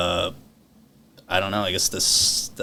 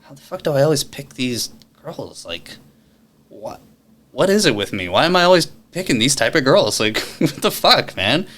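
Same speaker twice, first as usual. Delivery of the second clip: exasperated inner voice; 6 semitones higher than usual. Retold or imitated. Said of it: "I'm thinking to myself".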